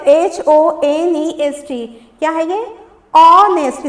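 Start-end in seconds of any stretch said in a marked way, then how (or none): 0.0-1.9 slow letter-by-letter reading; spelling out. Shown as a demonstration. Teacher reading the fourth word's spelling, spelling out. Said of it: "आइए spelling को पढ़ते हैं". 3.2-3.9 "honest" loud, opening on the vowel; silent H. Shown as a demonstration. Teacher answering what the word is, "honest" with silent H. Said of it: "इसमें silent एच है".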